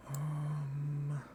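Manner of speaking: ordinary talk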